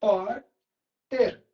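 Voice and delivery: very slow, long gaps between syllables of "Repórter". Shown as a demonstration. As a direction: break "Repórter" into re, pór, ter. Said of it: "separar a sílaba".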